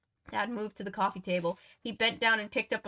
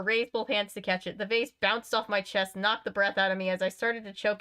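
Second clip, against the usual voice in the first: plain reading voice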